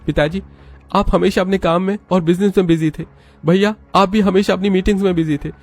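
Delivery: high-pitched bitter accusation; angry son. Acted. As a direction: confront the family one by one. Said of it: "सूरज गुस्से में".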